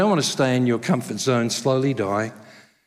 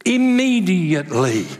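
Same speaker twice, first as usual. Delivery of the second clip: Immediately drawn out, slow and loud; stress on Immediately; 3 semitones higher, 3 dB louder than usual. Real, not acted. Pastor spotlights one word for the congregation, slow and emphatic. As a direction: lean hard on Immediately. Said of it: "Look at that word".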